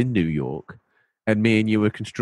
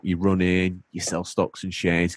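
ordinary talk

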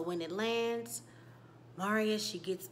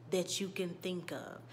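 usual speaking voice

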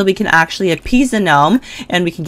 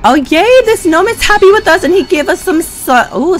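squealing yay, much higher and louder; excited; 13 semitones higher, 4 dB louder than usual. Real, not acted. A streamer thrilled the gnome gave gifts, high excited squeal. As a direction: squeal with delight at the gift. Gnome gift delights her.

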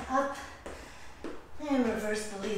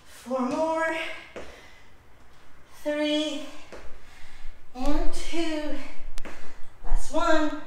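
counting workout reps; 3 semitones higher, 7 dB louder, much slower than usual. Real, not acted.

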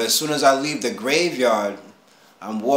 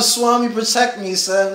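retold screaming plea; 9 semitones higher, 4 dB louder than usual. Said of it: "I'm just screaming out".

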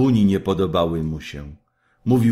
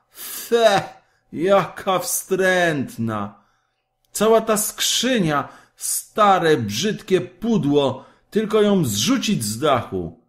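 scornful; 10 semitones higher, 3 dB louder, slower than usual. A narrator's reading. Kaj scorns the roses.

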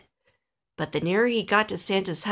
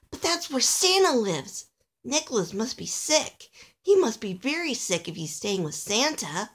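worried little Alistair; 4 semitones higher than usual. Acted. Little elf fretting over his sick friend, worried. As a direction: think aloud in growing concern.

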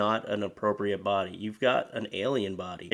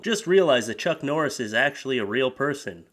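usual speaking voice

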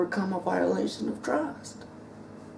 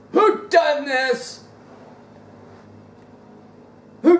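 preaching; 6 semitones higher, 4 dB louder than usual. Acted. Preaching at full force.

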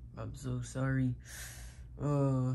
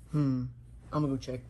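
ordinary delivery

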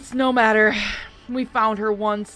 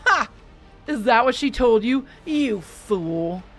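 scornful; 3 semitones higher, 7 dB louder, slower than usual. A narrator's reading. Markham scorns Melissa.

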